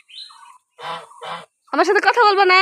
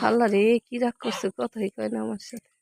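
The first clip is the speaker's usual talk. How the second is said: low, soft muttering to herself; quiet aside; 7 semitones lower, 7 dB quieter than usual. Real, not acted. To herself about the sulking cow, soft mutter. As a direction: mutter the complaint under your breath.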